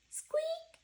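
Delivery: tiny high squeak; squeaky mouse noise. Acted. Reading to kids, a tiny high squeak.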